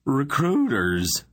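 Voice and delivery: alarmed exclamation. A narrator's reading. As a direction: shout the warning in panic. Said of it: "Varn exclaimed".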